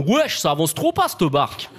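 lightly voiced street-slang youngster; mock youth-speak. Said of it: "en disant des trucs de jeunes".

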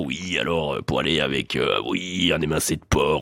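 very low, soft sommelier voice; sommelier under scrutiny. Acted. Sommelier advising fake clients before serious judges, low hesitant voice. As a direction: drop right down into a hesitant sommelier impression. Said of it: "hyper sérieux. Puis le sommelier, il est là genre".

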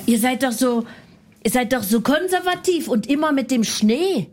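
ordinary delivery

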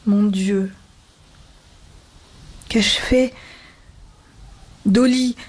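broken, halting plea rising in pitch; understated distress, long pauses; choked-up pleading. A narrator's reading. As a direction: plead haltingly, close to a sob. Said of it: "Un sanglot le prit à la gorge".

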